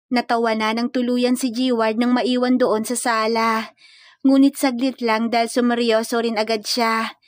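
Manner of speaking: ordinary delivery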